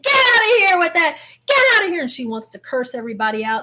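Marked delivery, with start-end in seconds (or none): 0.0-2.0 high, loud imitated heckling; crowd shouting. Onlookers to the cursing wife, high loud shouted imitation. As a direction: yell it like an outraged onlooker. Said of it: "people started saying".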